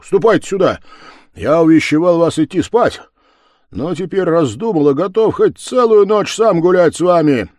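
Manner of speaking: loud, high, lively invitation; rally the lads to party all night; rousing call. Acted. Левко rouses the lads to revel, loud.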